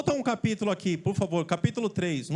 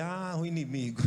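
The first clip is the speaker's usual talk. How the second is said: mocking laugh; 3 semitones lower than usual. Mocking the brother's excuse.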